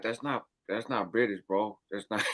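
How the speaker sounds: low, quiet aside to himself; dropped-volume self-talk; retold inner thought. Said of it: "in my mind, I was like".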